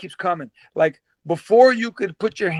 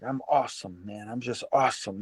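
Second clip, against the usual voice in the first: low, soft self-talk; talking to himself; 8 semitones lower, 11 dB quieter than usual. Retold or imitated. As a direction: say it under your voice, as a private thought. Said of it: "I'm saying to myself".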